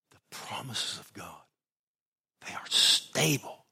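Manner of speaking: hushed preacher whisper. Real, not acted. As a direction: lean in and whisper the promise. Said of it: "whispered sermon line".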